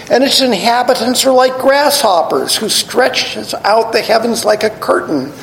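scripture reading. Acted. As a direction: proclaim the verses to the congregation. Reads scripture aloud, raised.

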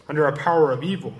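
ordinary talk

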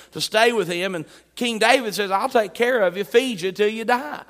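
ordinary talk, nothing marked

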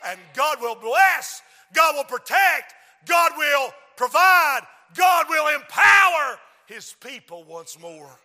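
loud, drawn-out preacher's shout; full-force preaching. Real, not acted. Preacher rallying people to stand for God, full-force preaching. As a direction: drive each promise home with a loud punch.

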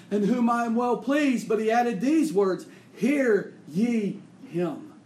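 loud, high preaching at full force, slowing to stress; fiery preaching. Real, not acted. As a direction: thunder it from the pulpit. Preacher booms God's words.

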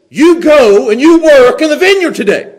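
loud, high, forceful command; commanding order. Shown as a demonstration. Preacher voices the father ordering his sons, loud command. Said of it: "there's a difference".

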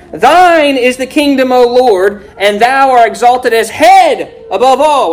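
slow, high, loud proclaimed verse; preaching scripture. Acted.

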